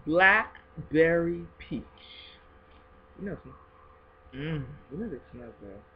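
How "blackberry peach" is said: slow savoring. Real, not acted. Reading scent name slowly.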